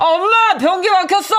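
high loud whine to mom; panicked cry. Acted. Son calling his mother about the clogged toilet, high and loud. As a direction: call for mom in distress.

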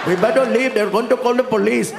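put-on worried Indian-accented getaway partner. Getaway partner fearing the police, Indian accent. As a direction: urge him to go in an Indian accent.

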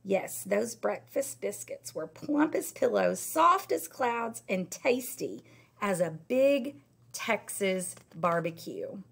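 ordinary delivery, nothing marked

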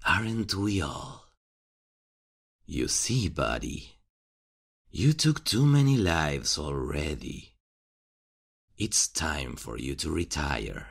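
ordinary talk, nothing marked

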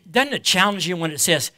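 ordinary delivery

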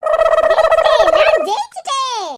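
loud, drawn-out victory cry; triumphant shout. Acted. Menaka escaping with the biryani, triumphant shout. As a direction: belt it out as a winner.

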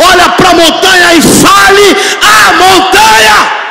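loud rising sermon shout, slow and heavy; preaching at full force. Acted. Pastor urging the church to drop their burden, shouting. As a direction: build it up to a full pulpit roar.

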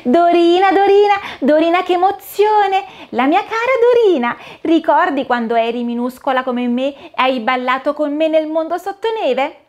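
high, squeaky, overjoyed mouse voice; a tiny mouse bouncing with joy; excited little mouse. Acted. (topolino felice, voce acuta e squillante)

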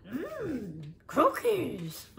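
a lightly put-on monster voice; mild Cookie Monster demo. Shown as a demonstration. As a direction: drool over the cookies. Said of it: "your best Cookie Monster voice".

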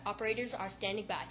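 quiet, rapid fine print read; fast disclaimer. Acted. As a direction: rush through it like fine print.

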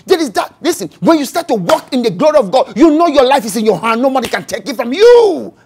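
loud, rising preacher's cadence; preaching at full force. Acted. Preacher retelling Jesus' arrest to the church, full force. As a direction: preach it out to the congregation.

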